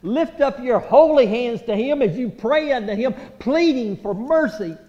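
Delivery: loud, ringing altar-call preaching; full-force preaching. Acted. Preacher urging the congregation to plead, at full force. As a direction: press the congregation hard to pray.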